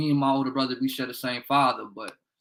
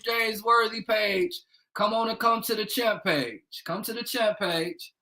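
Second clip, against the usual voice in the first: usual speaking voice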